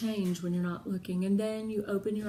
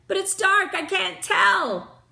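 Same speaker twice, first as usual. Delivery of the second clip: calling down; 8 semitones higher, 6 dB louder than usual. A narrator's reading. Jen peering down into dark well.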